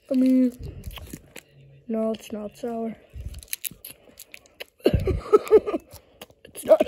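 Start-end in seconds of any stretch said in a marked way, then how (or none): 2.1-6.9 coughing fit. Real, not acted. Coughing on sour candy.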